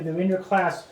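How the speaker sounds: ordinary delivery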